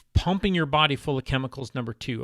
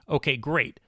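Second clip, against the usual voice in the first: ordinary talk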